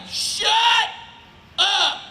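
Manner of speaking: short yell over the fence; neighbour yelling. Retold or imitated. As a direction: imitate a neighbour yelling at dogs. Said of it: "he yells at the dogs".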